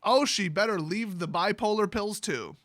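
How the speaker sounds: ordinary delivery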